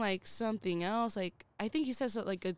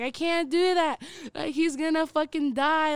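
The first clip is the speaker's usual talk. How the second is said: higher, louder panicked protest; freaking out; 6 semitones higher, 5 dB louder than usual. Retold or imitated. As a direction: blurt it out, freaking out. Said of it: "I was just freaking out".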